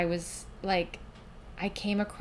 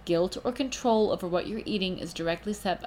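usual speaking voice

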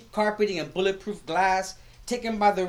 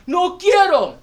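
put-on high, loud, slow kid's whine with a laugh breaking through; whiny child refusal; 10 semitones higher, 10 dB louder, slower than usual. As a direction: pout out the refusal like a stubborn little boy.

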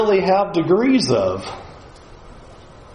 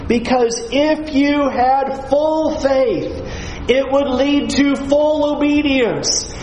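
lightly voiced God; 7 semitones higher than usual. Preacher voicing God correcting proud Israel, high and earnest. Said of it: "And God's saying".

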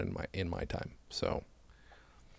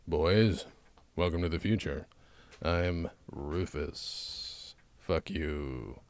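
low, slow, quiet Rufus impression; deadpan imitation, drawing out each phrase; Carlin as Rufus; 6 semitones lower, 6 dB quieter, much slower than usual. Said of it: "I played Carlin".

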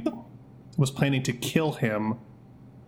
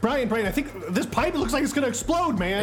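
panicked; 10 semitones higher than usual. Acted. Panicked call about exploding pipe.

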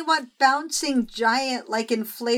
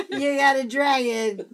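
laughing. Real, not acted. Retelling the joke through laughter.

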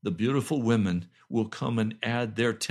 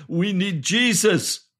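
loud, slow, raised preaching; emphatic cry; 7 semitones higher, 7 dB louder, slower than usual. Real, not acted. Preacher urges his sister they need Jesus, crying out. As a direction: drive it home at full voice.

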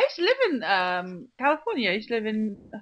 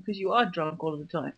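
ordinary talk

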